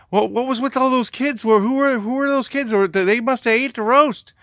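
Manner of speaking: high, loud mock alibi; hammed-up innocent bystander; feigned innocence. Man blames imaginary kids.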